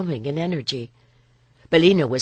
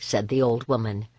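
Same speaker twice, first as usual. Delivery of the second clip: ordinary delivery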